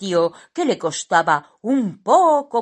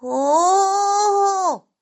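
high, loud drawn-out gasp of dismay; a little character's high startled "oh"; alarmed oh; 5 dB louder than usual. A narrator's reading. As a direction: caught out, squeaky dismay. Simón spots the staring dog.

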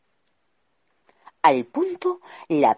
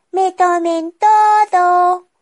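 very high, loud, slow squeaky gnome cry; gnome calling out; 11 dB louder, slower than usual. Acted. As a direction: shout it across the cavern like a goblin. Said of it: "la llamó otro gnomo".

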